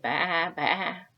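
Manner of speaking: loud bleats at speaking pitch; storyteller's bleating sheep. A narrator's reading. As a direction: imitate a sheep. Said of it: "No sheep said".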